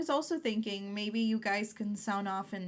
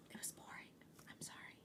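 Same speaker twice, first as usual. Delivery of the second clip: hushed guilty whisper; 24 dB quieter than usual. Real, not acted. Cohost admitting episode was boring, whispered apology. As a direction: breathe it out like a confession. Confessing the show was dull.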